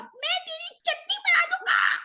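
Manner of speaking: very high, loud shrieking shout; screaming threat. Acted. The tiny Bogart screams a threat at Harry, shrieking. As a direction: shriek the threat at full volume. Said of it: "चिल्ला चिल्ला के कह रहा था".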